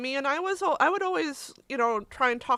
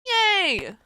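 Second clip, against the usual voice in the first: streamer cry; 8 semitones higher, 5 dB louder than usual. Real, not acted. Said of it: "there's my streamer cry".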